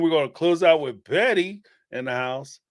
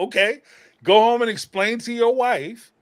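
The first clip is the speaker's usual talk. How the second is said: higher mock dismissal; sarcastic brush-off; 8 semitones higher, 4 dB louder than usual. Imagined reply telling Cody off, high sarcastic brush-off. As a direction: send him home with a smirk.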